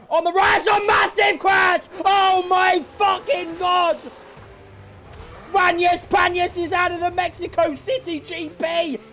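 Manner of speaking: a real racing caller losing it over a pile-up; shouting commentary. Real, not acted. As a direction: scream the crash call at the mic. Commentator sees a massive crash.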